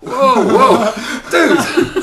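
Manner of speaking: high, loud laughing whoa; laughing disbelief. Real, not acted. Telling the story to the interviewer, laughing loud and high. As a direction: crack up in disbelief.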